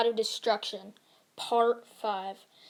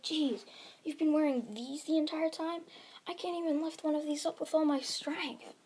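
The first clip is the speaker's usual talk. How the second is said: higher, hushed mock disbelief; incredulous; 5 semitones higher, 5 dB quieter than usual. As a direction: softly exclaim in amazement, pitch raised. Bakugo fails to lift weights.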